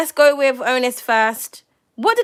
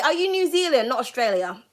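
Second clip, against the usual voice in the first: ordinary talk, nothing marked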